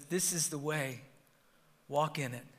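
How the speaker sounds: low, hushed voice of God; still small voice. Acted. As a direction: say it softly and low, as gentle guidance. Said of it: "we will hear that still small voice".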